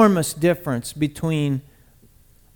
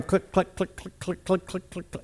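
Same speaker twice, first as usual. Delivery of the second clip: hushed clicks of the climb; soft clicking; 10 dB quieter than usual. Retold or imitated. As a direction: tick it off quietly, like the chain lift.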